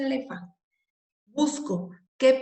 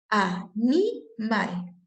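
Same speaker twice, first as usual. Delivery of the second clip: "Animal" very slowly, broken into a-ni-mal; syllable by syllable; much slower than usual. Shown as a demonstration. Teacher finding the stressed syllable, syllable by syllable. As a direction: stretch "Animal" out with clear gaps between syllables.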